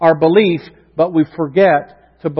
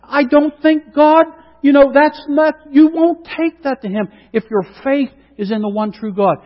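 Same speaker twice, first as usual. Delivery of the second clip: ordinary talk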